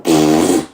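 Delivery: low lip-buzz fart sound; imitated fart noise. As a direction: blow a short raspberry. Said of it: "and just go".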